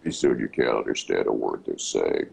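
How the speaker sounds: bottomed-out, rumbling mock radio voice; absurdly low announcer. Dad parodying announcers at their lowest, absurdly low voice. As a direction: go as low as your voice allows. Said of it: "they get lower and lower".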